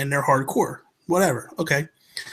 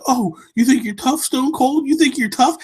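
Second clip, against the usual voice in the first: higher, sneering you think you're tough; mocking taunt; 9 semitones higher than usual. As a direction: taunt him like a wannabe mafioso.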